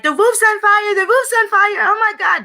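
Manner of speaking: high, frantic alarm; hammed-up yelling about a fire; panicked women. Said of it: "When we're like".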